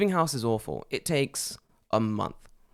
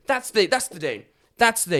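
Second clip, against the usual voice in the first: mock eager pouncing; 8 semitones higher than usual. Garbage company seizing on the customer's worst date, mock eagerness. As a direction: pounce on it, pitched way up.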